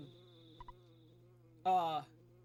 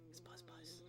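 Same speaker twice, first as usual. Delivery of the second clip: very soft murmured buzz, buzz; hushed buzz imitation; 3 semitones lower, 22 dB quieter than usual. Real, not acted. Showing viewers the bug's buzz, very softly. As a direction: barely breathe the buzz.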